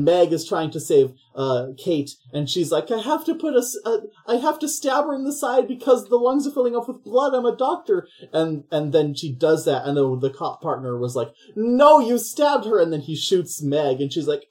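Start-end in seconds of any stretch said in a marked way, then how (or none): 2.9-8.1 much higher, hurried put-on voice; mock frantic doctor. Meg frantically saving Kate. 11.5-12.8 higher, louder, slow outraged cry; hammed-up accusation, dragged out; mock accusing yell. Cop partner accusing Meg.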